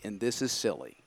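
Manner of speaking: ordinary talk, nothing marked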